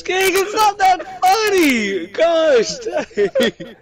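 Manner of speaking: laughing. Real, not acted. Laughing fit.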